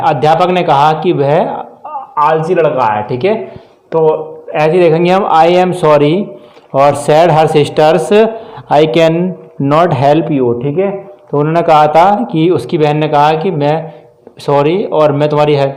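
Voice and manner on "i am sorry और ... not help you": very slow dictated words; slow dictation. Shown as a demonstration. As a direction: read the example slowly for copying. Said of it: "teacher dictating example sentence". (धीरे-धीरे वाक्य लिखवाना)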